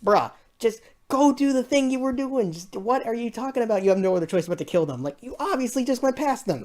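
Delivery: exasperated rant. Retold or imitated. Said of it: "I'm just like".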